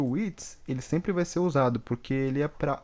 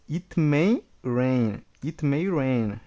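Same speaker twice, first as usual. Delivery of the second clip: slow, deliberate: it may rain; slow model reading; much slower than usual. Shown as a demonstration. Teacher drilling the class slowly. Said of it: "Pode chover".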